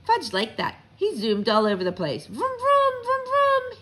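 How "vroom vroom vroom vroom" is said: put-on high-pitched child's engine noises; calling out vrooms. A narrator's reading. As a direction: call out like a kid zooming on a bike. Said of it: "he called".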